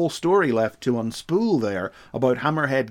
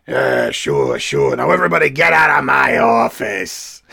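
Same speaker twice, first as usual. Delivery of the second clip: gruff chief shouting; 7 dB louder than usual. Retold or imitated. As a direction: grumble then bark them all out. Chief ordering everyone out.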